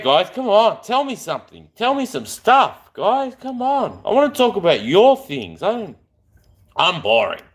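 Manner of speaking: normal talking voice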